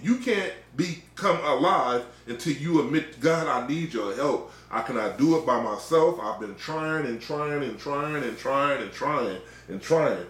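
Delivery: ordinary talk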